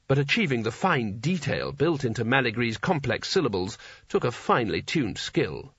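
ordinary delivery

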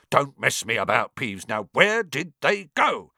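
put-on impatient grumpy old man; angry caretaker. A narrator's reading. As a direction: demand an answer, irritated.

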